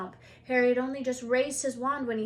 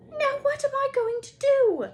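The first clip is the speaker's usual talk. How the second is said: much higher, loud and desperate; shrill panic; 10 dB louder than usual. Acted. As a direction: squeal it out in panic. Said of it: "she asked shrilly".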